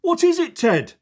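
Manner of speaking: put-on girl asking Ted what moved; curious child. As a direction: ask wide-eyed. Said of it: "says Poppy".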